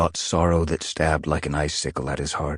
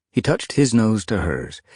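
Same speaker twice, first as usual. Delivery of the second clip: ordinary delivery, nothing marked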